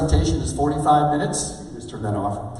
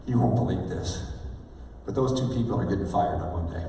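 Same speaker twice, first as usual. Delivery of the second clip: low, confiding punchline; deadpan reveal with a pause; Kevin; 7 semitones lower than usual. Retold or imitated. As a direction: imitate a boss sharing news, pitched down.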